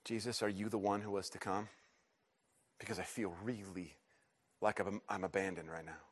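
soft, low confession; hesitant prayer. Acted. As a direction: ask it haltingly, almost afraid to. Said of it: "wanna ask but are afraid to".

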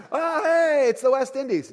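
high, loud, clueless explorer; hammed-up sailor's discovery; mock Columbus. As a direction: play the lost navigator, proud and wrong. Mocking Columbus's mistake.

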